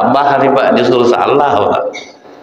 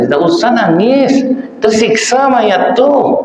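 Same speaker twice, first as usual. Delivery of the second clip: much higher, slow mock scolding of a mourning mother; scolding onlooker; 13 semitones higher than usual. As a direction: play the nagging neighbour, drawn out. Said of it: "sering saya mendengar pas begitu ibu ini nangis". (meniru orang yang melarang menangis, nada tinggi dan lambat)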